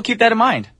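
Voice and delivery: usual speaking voice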